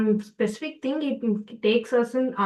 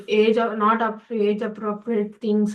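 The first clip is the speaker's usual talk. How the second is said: ordinary talk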